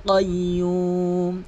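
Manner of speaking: slow, drawn-out melodic tilawat; Quran recitation. Acted. Reciting to viewers as a slow melodic model. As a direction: chant each word long and even.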